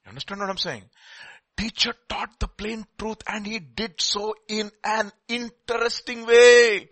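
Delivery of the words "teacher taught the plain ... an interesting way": drawn out. Acted. Savoring the verse slowly.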